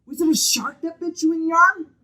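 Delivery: put-on high, loud child's voice; eight-year-old boy. Small boy calling to a stranger on the beach, high loud kid voice. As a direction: pipe up like a loud, curious little kid. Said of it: "probably eight-year-old boy".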